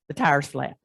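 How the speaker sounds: usual speaking voice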